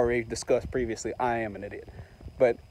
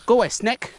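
raised, pitched-up call to chase off a snake; shooing a snake; 10 semitones higher than usual. Real, not acted. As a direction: yell it away from you.

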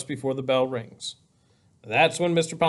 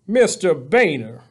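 slow, loud superintendent calling out; measured, commanding call; calm authority; 2 semitones higher, 6 dB louder, slower than usual. A narrator's reading. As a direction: call him to a halt, slowly and firmly. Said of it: "His calm voice".

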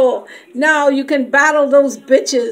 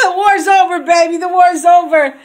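loud, higher, laughing cry of victory; triumphant shout; 4 semitones higher, 7 dB louder than usual. Real, not acted. Ending her product tour, she shouts out in loud triumph. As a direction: shout it out like you've won.

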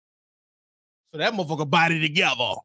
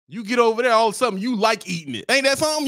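usual speaking voice

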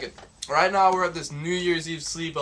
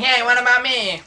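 high, loud, complaining voice; whiny left-out friend; 6 semitones higher, 6 dB louder than usual. Pretend. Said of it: "they think they got".

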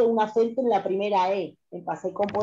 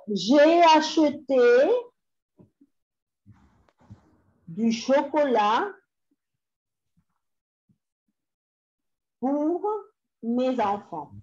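very slow, phrase by phrase, raised pitch; slow dictation; 4 semitones higher, much slower than usual. Shown as a demonstration. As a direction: dictate in drawn-out chunks with long pauses. Dictating French sentence to students.

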